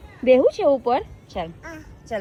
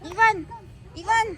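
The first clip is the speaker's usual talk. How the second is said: high, ringing call across the yard; 6 semitones higher than usual. Real, not acted. Mother calling her son across the garden, high and ringing.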